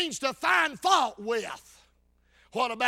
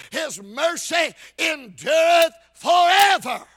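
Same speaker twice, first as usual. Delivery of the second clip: slow, loud preaching shout; ringing declaration of scripture; emphatic proclamation; 3 semitones higher, 7 dB louder than usual. Real, not acted. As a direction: proclaim it, drawing out each word. Preacher quotes psalm at full force.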